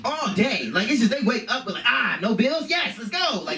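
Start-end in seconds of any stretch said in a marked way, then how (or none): 1.9-3.4 high-pitched burst of mock excitement; energetic kids. Kids waking up full of energy, high excited cheer. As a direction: play a kid bursting with energy. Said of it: "twelve noon, maybe one P M energy".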